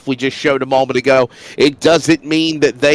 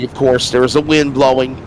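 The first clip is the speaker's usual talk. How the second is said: ordinary talk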